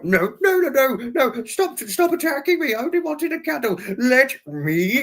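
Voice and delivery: very high, squealing fake terror; mock panicked pleading. Begging attacking dogs to stop, play-acted high panic. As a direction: plead in a hammed-up high wail.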